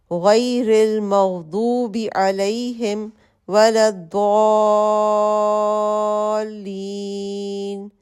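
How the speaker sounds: very slow, drawn-out recitation of al-Fatiha; Quran recitation. Shown as a demonstration. Teacher recites al-Fatiha slowly to demonstrate prayer. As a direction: stretch the long vowels as in prayer. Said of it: "اس کے بعد ہم سورہ فاتحہ پڑھتے ہیں".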